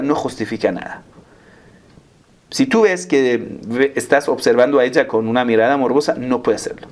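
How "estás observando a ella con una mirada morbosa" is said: usual speaking voice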